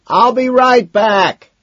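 high, loud, stretched-out call; slow yell over the crowd; shout. A narrator's reading. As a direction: holler as you head off. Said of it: "Madison shouts".

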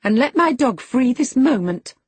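put-on high, loud girlish order; indignant command. A narrator's reading. Girl ordering the woman to free her dog, indignant high voice. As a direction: demand it at once, affronted.

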